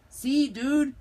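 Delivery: high, loud cartoon dog voice; Chihuahua doggies. A narrator's reading. Doggies reply to Skipito, high loud character voice. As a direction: pipe up loudly in a high voice.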